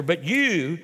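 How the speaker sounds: "you" drawn out with dramatic focus; emphatic YOU. Acted. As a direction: lean hard on the word "you". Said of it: "emphatic there".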